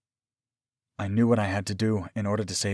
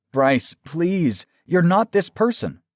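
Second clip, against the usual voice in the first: raised, high-pitched appeal; desperate pleading; 9 semitones higher, 5 dB louder, slower than usual. A narrator's reading. Aaron pleading with his armed lover, desperate. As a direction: appeal urgently to his better nature.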